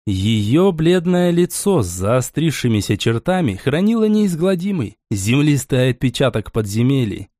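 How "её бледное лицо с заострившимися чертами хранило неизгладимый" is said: usual speaking voice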